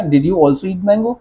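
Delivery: धीमा प्रश्न; slow model question. Shown as a demonstration. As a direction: ask slowly for dictation. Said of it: "also का use करके बनाना चाहता हूँ". (धीमा सवाल)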